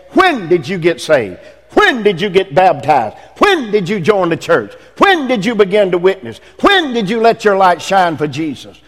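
each question opens with a hit on When; preacher hammering When; stress on when. Shown as a demonstration. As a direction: punch When at the start of every line. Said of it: "I underline that word when".

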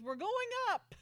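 high, loud mock urgency; urgent announcement. Lee telling Amanda they're taking off, high and loud. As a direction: announce it with a thrill. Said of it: "He goes".

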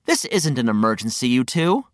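usual speaking voice

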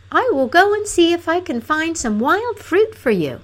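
high-pitched princess voice; eager-to-please princess. A narrator's reading. Princess offering to find food for Fanfaronade, placating.

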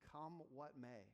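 usual speaking voice

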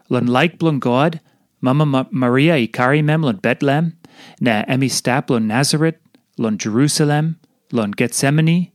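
usual speaking voice

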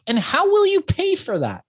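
high, worried disbelief; incredulous kid. Retold or imitated. Poor kid worried about paying, high and incredulous. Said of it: "And I'm like".

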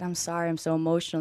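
normal talking voice

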